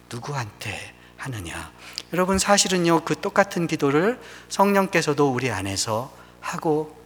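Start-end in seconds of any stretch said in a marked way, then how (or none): 0.1-1.7 much softer, weighing 누구한테; hushed emphasis. Shown as a demonstration. Preacher to church, hushed on 누구한테. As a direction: say 누구한테 almost under breath. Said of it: "중요합니다".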